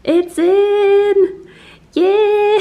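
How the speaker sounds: high, loud happy cheer; excited cheer. Real, not acted. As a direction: cheer it in with joy. Floor finally installed, cheering.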